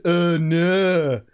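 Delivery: loud, drawn-out Yorkshire "oh no"; mock Yorkshire. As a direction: announce the joke tagline in broad mock Yorkshire. Mock Yorkshire film strapline.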